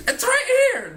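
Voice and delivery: high-pitched screaming through laughter; laughing scream. Pretend. Waving at aliens in the bar, laughing high scream. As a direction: yell it out while cracking up. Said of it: "and screaming".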